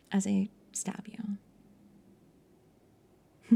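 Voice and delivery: mock menacing whisper. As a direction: hush it like a threat.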